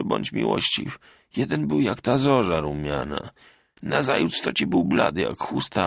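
mild tearful remorse; drunken lament. A narrator's reading. Bartek confessing war memories in the tavern, grieving. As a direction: grieve for the buried boys, then bark for vodka.

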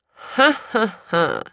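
przymilne ha ha ha, wysoko zaczęte; zaśmiej się słodko, z wyższością; słodki śmiech. Acted. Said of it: "rozśmiał się słodko pan Łukasz". (kupiec śmieje się słodko z ojca)

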